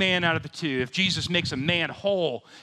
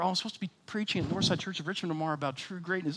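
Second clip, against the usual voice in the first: sudden dawning thought; 2 semitones higher, 5 dB quieter, faster than usual. Retold or imitated. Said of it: "And then I remember".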